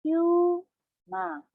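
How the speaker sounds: humang said slowly, hu-mang, each syllable clear. Shown as a demonstration. Teacher to class, slowly per syllable. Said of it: "Slowly, per syllable".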